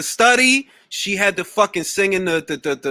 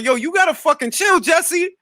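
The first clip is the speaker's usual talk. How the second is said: higher, imitating Zach telling Jesse off; mock scolding Zach; 8 semitones higher than usual. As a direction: snap at her to chill, half-acted. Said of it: "He's like".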